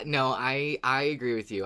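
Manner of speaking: ordinary delivery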